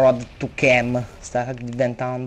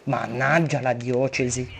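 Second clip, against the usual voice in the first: usual speaking voice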